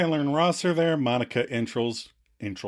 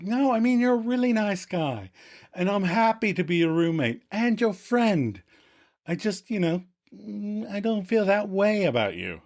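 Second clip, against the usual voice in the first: higher-pitched, hesitant friendly rejection; imitated gentle letdown; 7 semitones higher than usual. Host voices roommate turning Joey down, gently apologetic. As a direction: let them down kindly, pitched up.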